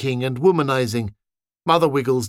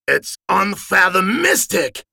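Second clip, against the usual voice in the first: higher, slow and pompous; announcing; 7 semitones higher, slower than usual. Acted. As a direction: proclaim the made-up word grandly. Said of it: "Richard announced".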